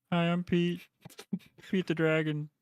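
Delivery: high, timid little dragon; wimpy dragon voice. Pretend. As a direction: play a meek dragon introducing himself. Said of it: "my impression of what Pete the Dragon would sound like".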